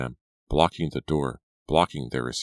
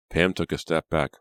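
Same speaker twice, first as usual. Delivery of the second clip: plain reading voice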